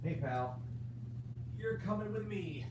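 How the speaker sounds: high, soft coo to the cat. Real, not acted. Owner picking up his sleepy cat, high soft coo.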